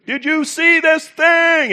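very high, loud drawn-out holler; hyped-up call over a microphone; announcer rallying a crowd. Acted. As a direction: whoop it to the whole stadium. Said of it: "somebody getting on the microphone and saying".

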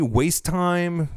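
ordinary talk, nothing marked

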